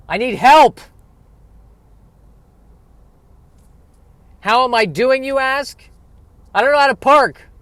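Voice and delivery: high, loud comic wail; mock desperate plea. Pleading to viewers about parking, high loud mock wail. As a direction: beg for help, hammed up.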